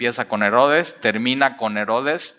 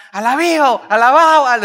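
high, loud hammed-up praise; overjoyed disciples cheering loud; jubilant praise; 12 semitones higher, 8 dB louder than usual. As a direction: whoop out the praise.

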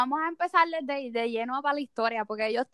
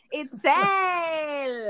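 announcer reveal. Acted. Announcing guest name.

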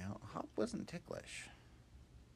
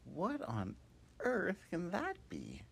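puzzled Hop; 12 semitones higher, 6 dB louder, slower than usual. Acted. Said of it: "And Hop was like".

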